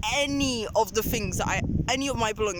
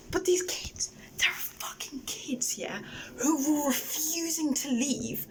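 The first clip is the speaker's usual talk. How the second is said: whispering; 3 semitones higher, 4 dB quieter, slower than usual. Real, not acted. Whispering about the kids who refused to leave, hushed.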